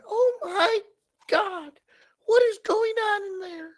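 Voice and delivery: put-on a shocked old lady crying out in falsetto. Old lady finds the bathroom chaos, crying out high. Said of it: "a little old lady".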